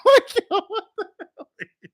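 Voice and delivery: high squeal of laughter, then rushed; laughing. Real, not acted. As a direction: choke on the words while cracking up. Cracking up at Shannon's tweet.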